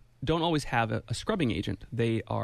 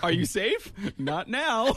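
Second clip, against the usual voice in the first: mock text dialogue; 9 semitones higher, much slower than usual. Acting out 911 text exchange.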